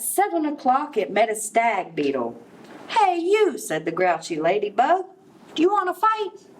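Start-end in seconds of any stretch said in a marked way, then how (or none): 2.9-3.6 high sharp hey you; high-pitched grouchy bug; calling out. A narrator's reading. Grouchy ladybug calls out. 5.5-6.5 high, pushy challenge; high-pitched cocky bug; picking a fight. A narrator's reading. Grouchy ladybug picks a fight.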